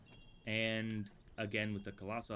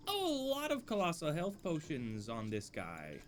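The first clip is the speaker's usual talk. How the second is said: ordinary talk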